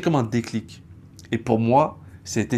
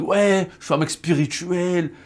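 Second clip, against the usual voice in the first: higher, hammed-up self-parody; mock boastful, raised voice; mock spiritual guy; 6 semitones higher than usual. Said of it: "j'étais là en mode".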